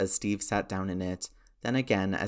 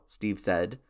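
plain reading voice

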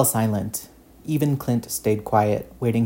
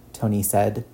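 usual speaking voice

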